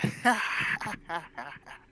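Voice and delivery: rising burst then falling cackle; acted gleeful laugh. Inventor laughing to herself over what she made, gleeful cackle. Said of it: "and then a".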